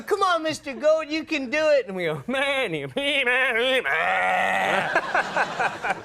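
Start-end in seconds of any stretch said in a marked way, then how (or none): 0.0-1.9 very high sing-song coaxing; coaxing the goat. Retold or imitated. Friends coaxing an old goat to bleat, high sing-song. 2.3-6.0 high bleating words; goat bleating. Retold or imitated. Mimicking goats in a field, high bleat.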